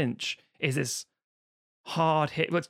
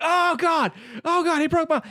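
shrill, much higher, louder panicked cry; mock panic; 14 semitones higher, 5 dB louder than usual. Podcaster mimicking Ricky Bobby panicking over his broken arm. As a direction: squeal in hammed-up pain.

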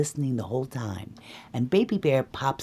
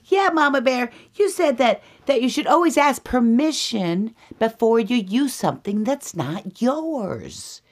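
high-pitched little cub voice; high cub reminding mama; Baby Bear; 11 semitones higher, 6 dB louder than usual. Acted. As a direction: pipe up like an earnest child. Baby Bear pipes up to mother.

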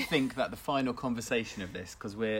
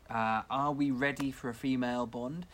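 ordinary talk

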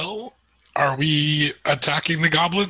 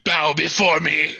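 booming demon lord; 3 semitones higher, slower than usual. Acted. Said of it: "my voice isn't booming anymore, but I scream".

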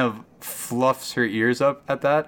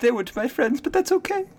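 much higher, anxious cow voice; frightened cow Claire. Acted. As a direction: squeak it out like a scared cow that saw nothing.

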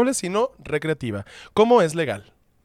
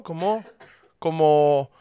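usual speaking voice

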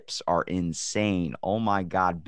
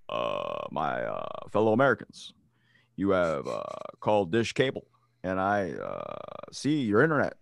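presidential voice; 2 semitones lower than usual. Pretend. Mock presidential voice actor phone.

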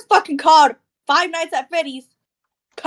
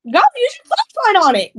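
urging; 9 semitones higher than usual. Real, not acted. Urging friend to use flashlight.

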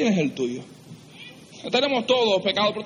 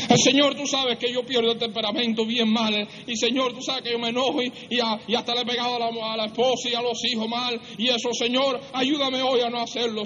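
higher-pitched, pleading confession; confessional prayer; 4 semitones higher than usual. Acted. Preacher acting a husband confessing his temper, pleading. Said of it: "Tú ponte esa mañana y di".

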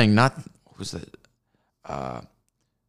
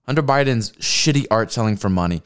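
normal talking voice

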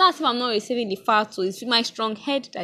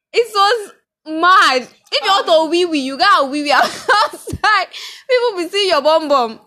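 excited; 7 semitones higher, 6 dB louder than usual. Retold or imitated. Excited church story, loud laughing.

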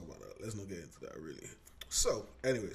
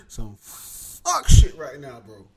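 loud emphatic rant; 4 semitones higher, 19 dB louder, much slower than usual. Real, not acted. Ranting about America.

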